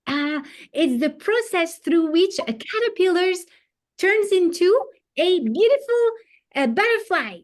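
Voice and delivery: put-on high, proud little boy explaining; a child showing off what he knows; eager child. Boy proudly answering his teacher, high and eager.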